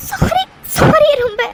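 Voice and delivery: tearful, slow, breaking sorry; choked-up sorry through tears; crying apology. Acted. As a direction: sob out the apology. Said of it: "எதுக்கு நீ sorry கேட்டு அழுதுட்டு இருக்க".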